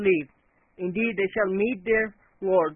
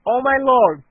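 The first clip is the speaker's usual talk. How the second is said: loud plea to heaven; calling out; 3 semitones higher, 8 dB louder than usual. Acted. Noah calls upon his Lord, a loud plea. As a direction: cry out to the Lord. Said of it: "Noah called upon his Lord".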